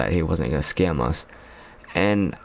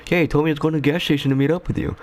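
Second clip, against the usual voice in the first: quick, higher, lightly put-on voice of the friend; friend's answer on the phone; 8 semitones higher, much faster than usual. Friend on the phone explains where he went, quick and high. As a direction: rattle it off fast and high like the other guy.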